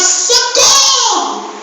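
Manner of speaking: drawn-out, high mock call for help; mock drowning man crying out, stretched and high; hammed-up cry for help. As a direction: wail socorro like a drowning man. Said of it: "E ele tava".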